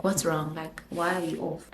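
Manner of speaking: soft, subdued imitation of a boyfriend asking; quiet concerned question. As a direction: voice his question low-key and quietly. Voicing boyfriend asking what's wrong.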